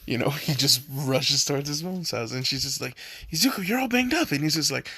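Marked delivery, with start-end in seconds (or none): 3.3-4.3 high, fast worried gasp; high fast worried-mom cry; shocked mom. Retold or imitated. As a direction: fuss over the hurt son. Mother gasps at son.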